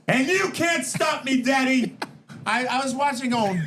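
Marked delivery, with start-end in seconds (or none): 0.1-2.0 slow, high shouted brag; Ric Flair impression. Imitating Flair's taunt to his cohosts, high and shouted. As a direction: taunt like a cocky champ. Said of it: "Ric Flair used to stand there and go".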